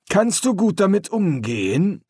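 gruff sergeant. A narrator's reading. Sergeant asks.

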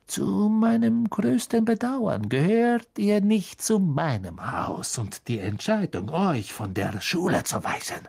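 icy verdict sinking to a hiss; cold stern teacher. Acted.

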